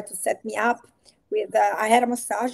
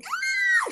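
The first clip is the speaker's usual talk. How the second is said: a sudden high retold cry, much higher; mock shout. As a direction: yelp out a pretend shout. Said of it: "like, shouting".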